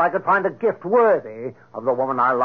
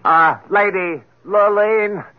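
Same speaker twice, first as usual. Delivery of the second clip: louder, higher announcer call; court announcement; 5 semitones higher, 4 dB louder than usual. Acted.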